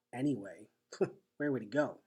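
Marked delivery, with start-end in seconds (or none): none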